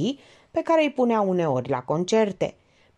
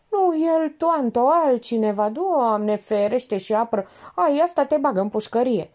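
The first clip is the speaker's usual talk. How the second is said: high, emphatic dialect rebuke; exasperated Georgeta; 6 semitones higher, 3 dB louder than usual. A narrator's reading. Georgeta corrects Elena's wrong guess, exasperated. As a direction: correct a dimwit, exasperated and alarmed.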